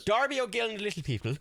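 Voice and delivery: a playful Irish lilt on the title; Irish accent. Shown as a demonstration. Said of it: "You gotta say it with an Irish accent".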